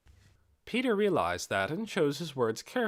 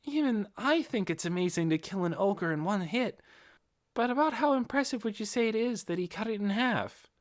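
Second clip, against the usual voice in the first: put-on higher, lighter young man's voice, curious; higher-pitched Inferia, asking with interest; Inferia's voice; 10 semitones higher than usual. A narrator's reading. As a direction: voice the eager young apothecary, pitched up.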